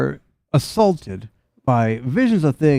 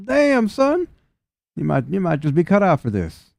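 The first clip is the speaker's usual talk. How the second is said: impressed fox man; 7 semitones higher than usual. Acted.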